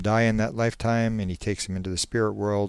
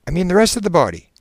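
fast, high, loud correction; quickly; 10 semitones higher, 8 dB louder, much faster than usual. Acted. Hypnotist corrects the panicking subject, quickly. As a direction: jump in quickly and urgently.

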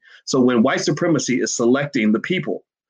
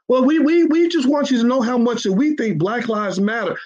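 mock politician; 11 semitones higher than usual. Mocking politicians' pitch.

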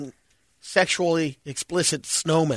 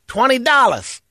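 high, loud street hustler; homeless hustler voice; 7 semitones higher, 4 dB louder than usual. Retold or imitated.

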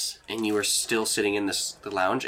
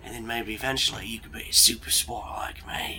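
whispered conspiratorial aside; secret whisper; 5 dB quieter than usual. Acted. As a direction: let him in on a secret, whispering. Mentor hints at secret past.

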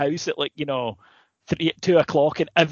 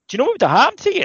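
much higher, louder disbelief; incredulous; 12 semitones higher, 5 dB louder, much faster than usual. Retold or imitated. Partygoers shocked at the friend's story, incredulous. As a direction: gasp it out in disbelief.

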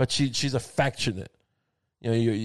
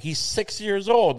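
higher, drawn-out mock disbelief; mock shocked protest; 8 semitones higher, slower than usual. Husband objects to tomato wife's threat, mock shocked. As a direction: protest in stretched, pitched-up disbelief.

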